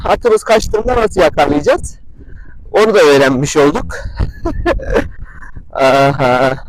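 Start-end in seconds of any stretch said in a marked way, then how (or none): 4.0-5.7 very high, breathy giggle; squeaky little laugh. Real, not acted. Vlogger amused at his derailed lesson, giggling. Laughs at botched video concept.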